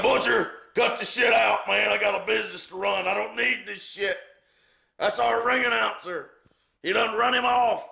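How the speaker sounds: acting out a high, loud angry scolding; exasperated shout. Promoter on crutches telling Butcher to stop, exasperated shout. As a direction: yell it like the boss telling someone off.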